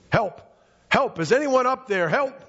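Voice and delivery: high-pitched cry for help at moderate volume; mock yelling for help. Jack on the cliff hoping a passerby hears, mock yelling. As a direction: call up high for rescue without full force. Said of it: "he began yelling for help".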